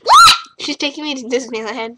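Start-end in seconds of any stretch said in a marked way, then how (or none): 0.0-0.4 very high, loud What; shocked shriek. Real, not acted. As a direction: squeal in disbelief. Disneyland news.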